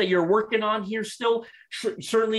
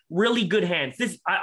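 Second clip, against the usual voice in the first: ordinary delivery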